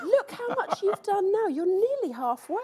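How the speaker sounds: high, bright praise; encouraging cheer. Retold or imitated. Mimicking encouraging pattern.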